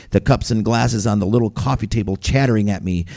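usual speaking voice

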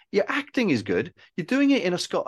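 high-pitched imitation of a mate's praise; insistent praise. Friend praising the filmmaker's acting, retold insistence.

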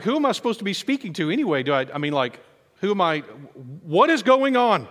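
high, loud disbelief rising to the last line; who am I even talking to, flustered; overwhelmed bewilderment. Pretend. Overwhelmed listener facing the president's entourage, high and loud.

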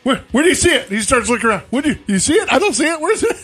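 high, loud puzzled dog voice; startled dog voice. Retold or imitated. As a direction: play the dog popping up, looking around, high and loud.

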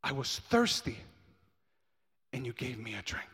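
building preacher's cadence, rising in pitch and force; dramatic scripture reading. Real, not acted. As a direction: punch the key word, then pause.

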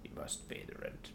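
whispered sneering villain; mustache twirling villain. Acted. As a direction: hiss the threat under the breath. Melodrama reprise, whispered.

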